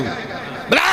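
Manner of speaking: ordinary talk, nothing marked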